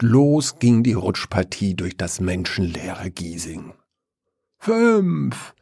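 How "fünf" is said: high, called-out numbers; calling out speeds. A narrator's reading. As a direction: announce each speed up high. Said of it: "vermeldete Wiesel". (hohes Ansagen der Zahlen)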